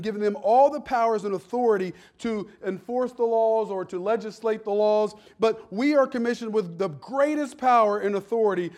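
preaching with growing force; rising sermon cadence. Acted. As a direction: lift the congregation with the point. Preacher builds to full force.

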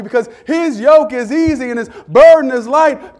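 loud, rising preacher's cadence; preaching. Acted. Pastor preaching to the congregation, full force.

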